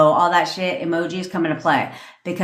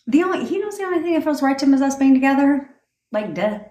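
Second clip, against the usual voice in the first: higher, quick and smug; conceited attitude; 8 semitones higher, faster than usual. Shown as a demonstration. Coach showing conceited attitude about her ex, high and smug. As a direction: act like it's obvious, duh. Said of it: "It's that kind of attitude".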